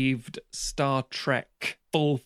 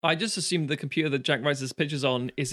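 usual speaking voice

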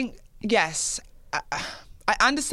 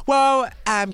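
ordinary talk

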